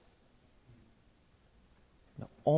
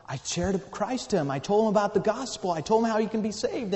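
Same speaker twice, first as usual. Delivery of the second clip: high, earnest retelling climbing in pitch; building fervor; 7 semitones higher than usual. Retold or imitated. Traveler telling the widow he shared Christ, increasingly fervent. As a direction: let the testimony rise higher as it goes. Retells witnessing to her husband.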